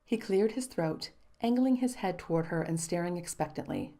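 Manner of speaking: ordinary delivery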